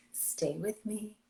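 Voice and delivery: slow, soft and sweet; sweet sexy voice. Shown as a demonstration. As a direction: coax him gently, slowly. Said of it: "in that sweet, sexy voice".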